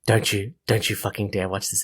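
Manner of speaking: low, menacing mock warning; playfully stern and ominous; mock threat.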